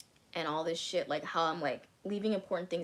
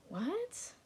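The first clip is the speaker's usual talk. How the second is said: high squeaked disbelieving what, much higher; stunned what; 14 semitones higher, 4 dB quieter than usual. Retold or imitated. Stunned reaction to boyfriend's words.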